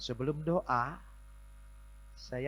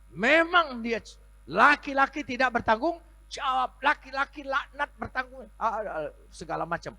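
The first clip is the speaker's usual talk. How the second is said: high, loud cursing rant; furious cursing; 13 dB louder than usual. Retold or imitated. Pastor cursing the husband for the woman, high and loud. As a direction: pile the curses on him.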